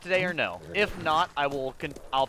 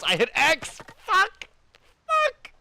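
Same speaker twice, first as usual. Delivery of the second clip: frustrated yelling; 5 dB louder, much slower than usual. Real, not acted. Keeps hitting wrong button.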